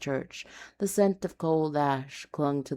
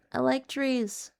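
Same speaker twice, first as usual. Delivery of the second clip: lightly higher deadpan retort; dry reply; 10 semitones higher than usual. Acted. Narrator answering his friend's tease, mild deadpan. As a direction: toss off a dry quip.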